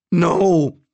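loud, high cry of protest. A narrator's reading.

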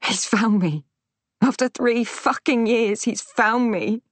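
high-pitched, tearful panic; distraught and close to breaking; sobbing distress. A narrator's reading. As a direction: act it out through sobs, voice climbing. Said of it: "I let out a sob".

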